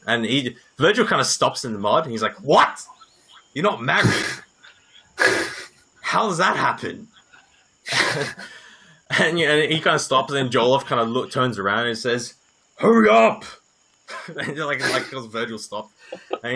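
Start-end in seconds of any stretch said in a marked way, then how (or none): 2.4-7.1 higher, incredulous disbelief; can't believe he's not married; surprised. Acted. Virgil stopping in the mud, surprised Raylan isn't married. 12.8-13.4 high, loud call to hurry; impatient shout. Acted. Jolov turning around to yell at the lagging Virgil, impatient shout.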